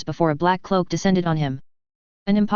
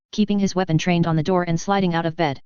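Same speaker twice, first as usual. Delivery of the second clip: usual speaking voice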